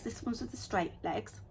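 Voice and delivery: ordinary talk, nothing marked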